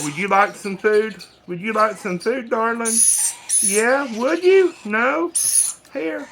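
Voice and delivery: high, sweet coaxing pet voice, peaking on you. Real, not acted. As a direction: gently coax the lorikeet to eat. Offering food to lorikeet.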